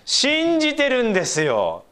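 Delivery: preacher stressing 信じてる, slow and high. Real, not acted. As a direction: lean hard into 信じてる. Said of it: "emphasis on believing".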